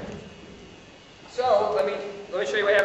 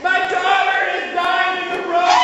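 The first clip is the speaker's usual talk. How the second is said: high, loud, drawn-out wail of alarm; slow hammed-up shout of anguish; panicked shout; 8 semitones higher, 7 dB louder, slower than usual. Father over his dying daughter, panicked shouting.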